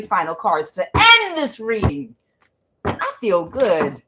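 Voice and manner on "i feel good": usual speaking voice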